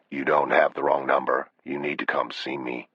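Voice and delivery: low, soft menace on the phone; quiet, controlled threat; menacing stranger. Acted.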